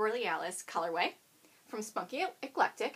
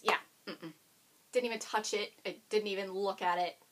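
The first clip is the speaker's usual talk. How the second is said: normal talking voice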